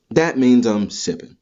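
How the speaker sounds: ordinary talk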